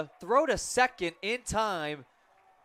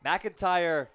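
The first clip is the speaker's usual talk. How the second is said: normal talking voice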